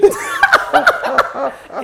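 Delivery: squealing laugh, high and loud; high burst of laughter. Real, not acted. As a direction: crack up laughing. Laughing at own story.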